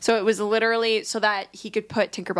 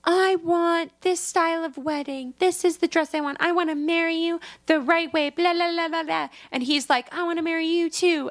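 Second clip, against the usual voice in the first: mock dreamy romance; 6 semitones higher than usual. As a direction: swoon over a wedding. Tinkerbell and Hook at night.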